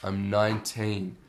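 slow, spelled-out answer; patient repetition. Retold or imitated. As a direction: say it slowly so it finally gets across. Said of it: "And I say, like, one more time".

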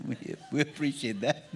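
chuckling through the words; laughing. Real, not acted. Pastor laughing at a latecomer, laughing. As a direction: laugh it off warmly.

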